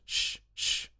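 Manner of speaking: drawn hushing sound; shushing. A narrator's reading. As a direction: hush someone with two soft shushes. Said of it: "the Hatter and the March Hare went".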